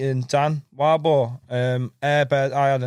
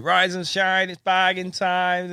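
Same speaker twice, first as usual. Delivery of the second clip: mock trader's cry, pitched up; market trader's shout; 6 semitones higher, slower than usual. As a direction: imitate a young market hawker calling out. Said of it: "you know, shouting and that".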